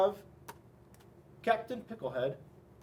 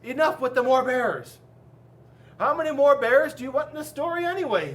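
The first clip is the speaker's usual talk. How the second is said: loud, high-pitched exasperation; a little angry; 6 semitones higher, 9 dB louder than usual. A narrator's reading. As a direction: scold the interrupters, voice raised. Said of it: "starting to get a little angry".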